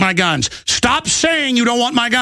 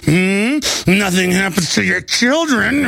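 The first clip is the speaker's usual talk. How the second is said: creepy, drawn-out veiled threat; mock menacing; slower than usual. Host imitating panel talk about his children, hammed-up menace. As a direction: insinuate it with a questioning hum. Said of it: "these weird looks on their faces".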